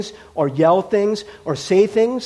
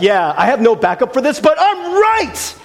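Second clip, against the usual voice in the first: loud, higher, slowed-down mock insistence; mock bluster; 6 semitones higher, 6 dB louder than usual. Preacher mocking himself pounding the podium, loud mock insistence. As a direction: pound the podium on "but I'm right". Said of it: "It's like".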